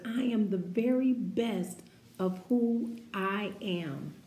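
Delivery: ordinary delivery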